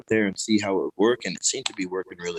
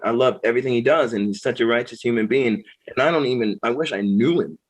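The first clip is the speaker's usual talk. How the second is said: ordinary talk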